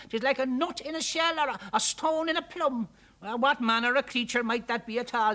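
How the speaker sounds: high, loud Irish chatter; excited Irish onlookers. A narrator's reading.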